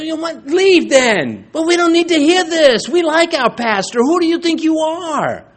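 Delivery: angry confrontation. Retold or imitated. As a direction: put the bad-mouther in his place. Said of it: "And so I said".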